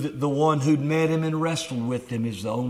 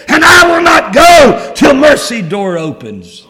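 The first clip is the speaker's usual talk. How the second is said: building from earnest plea to a full, high shouted cry; pleading shout to the Lord; 6 semitones higher, 16 dB louder than usual. Pretend. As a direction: cry out to God, refusing to let go. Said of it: "You'll say".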